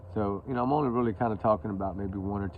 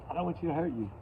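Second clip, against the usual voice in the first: ordinary talk, nothing marked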